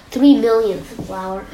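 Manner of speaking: ordinary delivery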